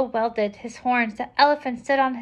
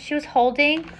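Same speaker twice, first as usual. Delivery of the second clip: usual speaking voice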